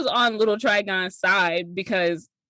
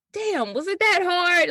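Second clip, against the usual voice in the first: mock exasperated villain; 10 semitones higher than usual. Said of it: "he was like".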